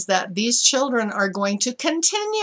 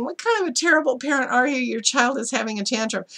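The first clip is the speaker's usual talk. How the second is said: higher, quick mock disapproval at first; judgmental onlooker; 5 semitones higher, faster than usual. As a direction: lightly act a scandalized bystander. Onlookers judging the parent, voiced.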